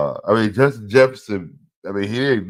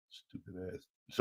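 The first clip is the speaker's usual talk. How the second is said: unvoiced muttered insult; slow whispered swear; whispered curse; 4 semitones lower, 20 dB quieter, slower than usual. Real, not acted. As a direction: whisper it under your breath. Cursing under breath at record.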